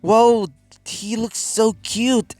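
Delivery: put-on high, drawn-out girlish swoon; admiring coo, stretched out; gushing admiration. Girls admiring the new boy.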